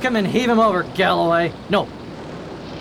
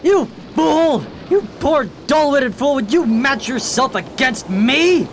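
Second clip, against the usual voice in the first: high, mocking scorn; scornful taunt; 6 semitones higher than usual. Sharky mocks Craddock as a dull-witted fool, high and scornful. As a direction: sneer down at the fool who walked in. Pirate taunts the captured captain.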